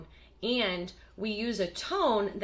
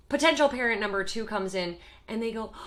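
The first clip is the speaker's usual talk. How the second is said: ordinary delivery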